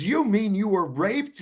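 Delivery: lightly voiced stunned son; shocked son. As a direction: retell the son's horror a bit slowly. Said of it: "he goes".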